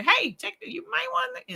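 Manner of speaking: very high, light heads-up; gentle reminder. Acted. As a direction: lightly act out a kindly nudge. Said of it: "gentle reminder".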